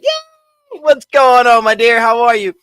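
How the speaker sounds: loud, high cheer to a friend; loud happy welcome; excited welcome. Real, not acted. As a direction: greet the guest with a squeal of joy. Greeting guest arriving live.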